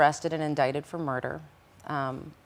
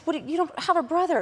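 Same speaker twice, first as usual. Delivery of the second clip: incredulous question. Retold or imitated. Retelling confronting the boyfriend.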